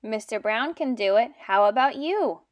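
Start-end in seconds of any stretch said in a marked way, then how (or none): none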